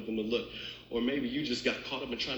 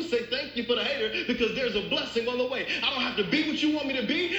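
higher and louder, driving sermon cadence; preaching at full force; 9 semitones higher, 4 dB louder than usual. Acted. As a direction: lift the church with rising preaching.

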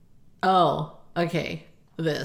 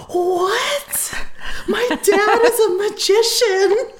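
high, drawn-out amazement; hammed-up wonder, pitched way up; imitating awestruck child; 13 semitones higher, 5 dB louder, much slower than usual. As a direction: squeal it like a kid who just saw magic. Acting her awestruck child self.

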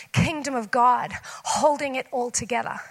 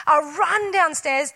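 usual speaking voice

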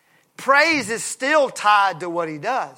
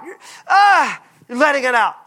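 passionate outburst; 5 semitones higher, 7 dB louder than usual. Real, not acted. Preacher erupting with passion.

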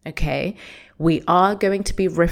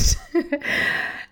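high breathy excited laugh; a giddy little laugh; excited giggle; 11 semitones higher than usual. Real, not acted. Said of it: "I am so excited".

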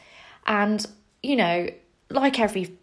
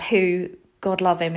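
usual speaking voice

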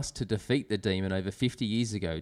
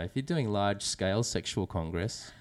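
ordinary talk

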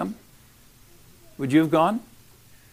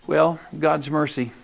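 ordinary talk

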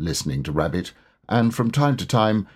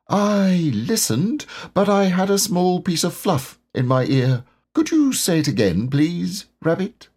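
Pooh voice; 9 semitones higher than usual. A narrator's reading. Pooh voiced slow and high.

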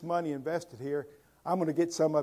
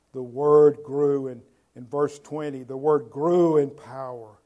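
preacher booming the verse; loud proclamation; 12 dB louder than usual. Acted. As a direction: ring the verse out to the hall.

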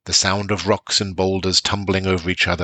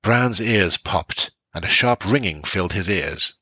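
usual speaking voice

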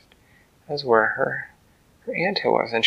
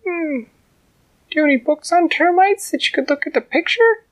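high, loud little ant; tiny ant voice; 5 dB louder than usual. A narrator's reading. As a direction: speak up boldly as the tiny ant.

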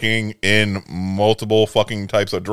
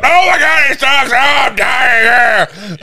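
much higher, louder hammed-up panic; mock freak-out; 12 semitones higher, 4 dB louder, slower than usual. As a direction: ham up a dramatic meltdown over the drink. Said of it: "I wanna say I'm freaking out".

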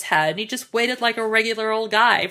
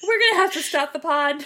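ordinary talk

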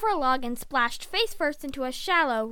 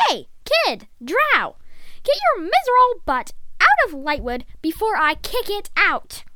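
loud, higher threatening yell; angry shout; 6 semitones higher, 6 dB louder than usual. A narrator's reading. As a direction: holler down from a treehouse. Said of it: "Drath heard a shout from above".

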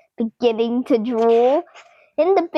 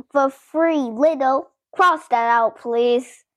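usual speaking voice

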